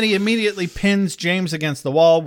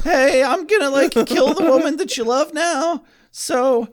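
high, loud Morty-style stammer; 10 semitones higher, 4 dB louder than usual. Pretend. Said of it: "they're both voiced by Morty".

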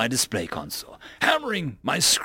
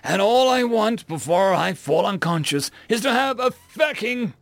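desperate angry shouting; 7 semitones higher than usual. A narrator's reading. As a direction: beg and rage at once. Exhausted customer shouts his plea.

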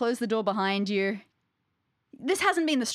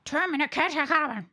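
raised-pitch elf speaking gibberish; 4 semitones higher than usual. Acted. Game master repeats the elf's gibberish to baffled players, elf voice. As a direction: deliver nonsense words as a strange little elf. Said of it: "The elf voice is".

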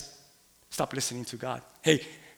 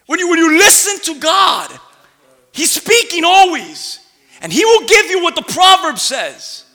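loud, higher fervent preaching; preaching at full force; 9 semitones higher, 11 dB louder than usual. Real, not acted. Preacher exhorts the church about God's voice, loud and fervent. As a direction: press it in with passion.

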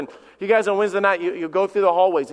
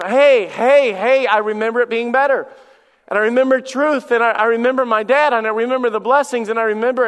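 higher and louder, longing and urgent; prodigal son's yearning cry; 5 semitones higher, 6 dB louder than usual. Acted. A preacher voicing the prodigal son, yearning and louder. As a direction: cry it out like a son remembering home.

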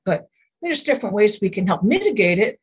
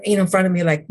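normal talking voice

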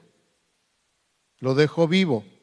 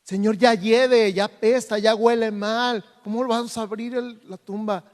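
queja de Marta; 6 semitones higher, 5 dB louder than usual. Retold or imitated. Marta objects again, acted.

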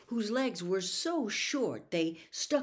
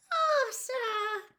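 high little elf voice, drawn out; Winky's squeak. A narrator's reading. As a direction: squeak it regretfully as a house elf. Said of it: "quivering squeak of a voice".

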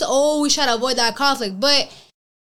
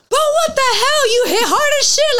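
much higher, louder, stunned and laughing; shocked outcry; 13 semitones higher, 4 dB louder than usual. Retold or imitated. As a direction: yelp like you just got hit hard. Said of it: "then they're like".

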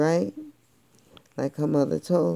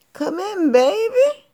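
very high, falsetto granny voice; cat croon it as a sweet old grandma; fake grandma; 7 dB louder than usual. A narrator's reading. Cat disguised as Grandma calling her in. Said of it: "act like he's got a cold".

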